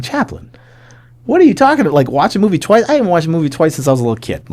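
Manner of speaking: high-pitched, incredulous protest; acted exasperation. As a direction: complain like you can't believe the request. Acted protest at being told to rewatch.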